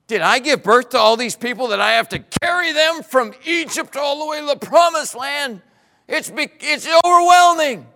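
fed-up protest building in pitch; exasperated complaint. Acted. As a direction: vent your frustration at God. Moses complains to the Lord.

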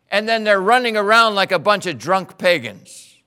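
loud, indignant divine voice; angry God. Acted. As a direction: thunder it out like an outraged God. God angrily reports Israel's idolatry.

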